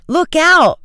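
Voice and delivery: high, loud warning shout; urgent alarm cry. A narrator's reading. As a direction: yell a sudden warning. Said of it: "Frank shouted".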